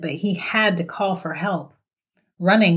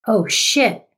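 blurted; 4 semitones higher than usual. A narrator's reading. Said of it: "Noah blurted out".

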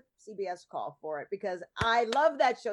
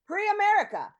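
high, surprised echo of a phrase; mock surprise; 11 semitones higher, 6 dB louder than usual. Real, not acted. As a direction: repeat it with delighted disbelief.

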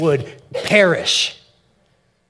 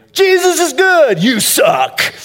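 much higher, louder mocking shout; mock hypocrite post; 7 dB louder, slower than usual. Pastor mocks a churchgoer's rude online post, shouted. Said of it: "That's probably not an appropriate word".

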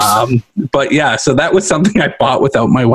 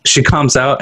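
ordinary talk

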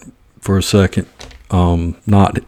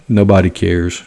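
normal talking voice

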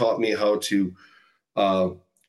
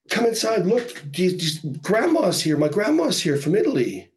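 put-on higher, eager child's voice calling her friend; a small girl insisting her grandma is here; excited little girl; 7 semitones higher than usual.

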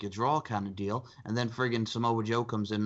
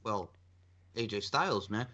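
normal talking voice

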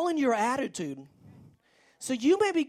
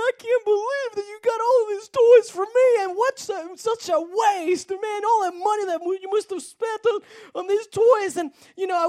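put-on high, loud sobbing boy voice; a hammed-up boy sobbing over his toys; crying boy; 10 semitones higher, 7 dB louder than usual. Negative boy sobbing to his mom and dad.

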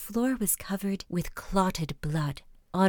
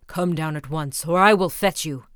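lower, loud furious bellow; bawling Bluebeard; 4 semitones lower, 6 dB louder than usual. A narrator's reading. Husband bawling at his wife to come down, low and loud. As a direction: roar the order. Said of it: "bawled as loud as he could".

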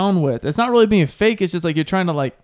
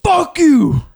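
loud, sudden fuck you; mock fury; 11 semitones higher, 7 dB louder than usual. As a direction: flip from tears to rage. Imagined player turning on team.

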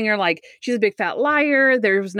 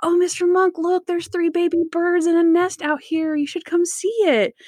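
high, sing-out coaxing voice; coaxing Natalie; 9 semitones higher than usual. Retold or imitated. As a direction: call him over to look, pitched way up. Acts Natalie coaxing Monk to look.

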